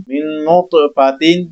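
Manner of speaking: من نطفاتن said slowly with heavy ط. Shown as a demonstration. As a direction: dwell on نطفاتن, heavy and slow. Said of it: "یہاں پہ تا موٹا پڑھا جاتا ہے". (من نطفاتن، موٹی ط اور آہستہ)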